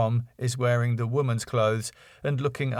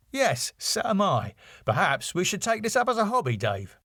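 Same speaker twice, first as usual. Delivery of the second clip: higher, quick wry Tom; 8 semitones higher than usual. A narrator's reading. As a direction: joke back at your partner mid-dance. Tom voiced in read script.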